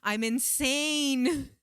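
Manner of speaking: high, long-drawn wail; retold panic. Retelling her plea for help, panicked wail. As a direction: stretch it into a desperate cry.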